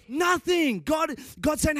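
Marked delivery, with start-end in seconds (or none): 0.1-0.8 "Nothing" shouted high and loud, falling; stress on Nothing. Shown as a demonstration. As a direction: hammer the word "Nothing". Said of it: "Say nothing".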